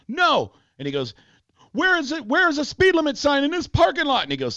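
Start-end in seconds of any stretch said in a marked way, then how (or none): 0.1-0.5 high, loud mock refusal; defiant refusal. Driver refusing to give police his ID, high defiant cry. 1.7-4.2 high-pitched mock outrage; indignant challenge. Driver challenging cop over the speed limit, high and indignant.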